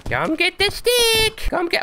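very high, slow drawn-out call; dog-calling voice. Acted. Teasing the shark with a stick, very high slow call. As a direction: sing it out like tempting a puppy.

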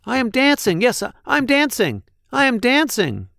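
high, delighted exclamation; joyful cry. A narrator's reading. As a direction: burst out with pure amazement. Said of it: "Gerald cried".

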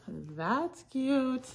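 CUTE sliding up high; high-pitched gushing. Real, not acted. Reseller admiring little pockets on shorts, high-pitched gushing.

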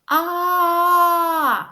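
loud crying aah with mouth wide; sobbing A sound. Shown as a demonstration. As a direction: cry out an open A like a weeping child. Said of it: "який звук ми чуємо".

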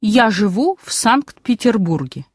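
normal talking voice

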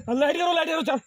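loud, high urgent call; frantic shout. Real, not acted. Friend shouting for the light in the dark, frantic loud call. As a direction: yell for the torch in panic.

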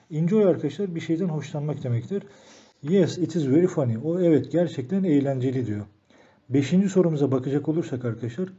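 ordinary talk, nothing marked

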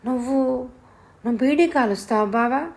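put-on high, hesitant child's question; timid, fearful question. A narrator's reading. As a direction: ask him nervously, halting after the first word. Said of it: "భయం భయంగా అడిగింది". (భయం భయంగా)

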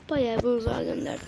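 ordinary delivery

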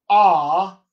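"ah," loud, open and unrounded; open back unrounded. Shown as a demonstration. Coach showing a lexical set's vowel, loud unrounded "ah,". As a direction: hold out "ah," with unrounded lips. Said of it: "that open back unrounded vowel".

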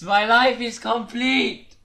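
loud, high, slow cheer; excited shout. Real, not acted. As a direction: shout it in joy. Ranks up in game.

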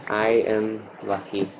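ordinary talk

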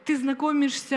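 usual speaking voice

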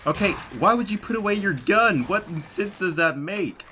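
high outraged rant, slowing down; exasperated. Real, not acted. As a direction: vent in disbelief. Outraged at teammate AI.

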